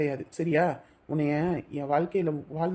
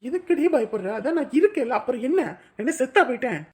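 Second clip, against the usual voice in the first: higher and louder, insistent; forceful reassurance; 8 semitones higher, 5 dB louder than usual. Acted. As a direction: shout down her fear. Lover forcefully reassuring crying girl. (அழும் காதலியை உரத்த குரலில் தேற்றுதல்)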